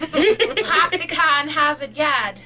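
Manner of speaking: slow Boston accent, no R's. Shown as a demonstration. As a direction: drop every R, pahk the cah. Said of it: "It's not hard to do a Boston accent".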